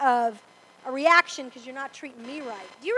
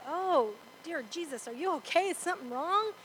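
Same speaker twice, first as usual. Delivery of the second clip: hushed worried compassion; tender concern; 7 dB quieter than usual. Retold or imitated. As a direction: gently ask if they are okay.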